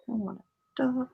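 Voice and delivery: usual speaking voice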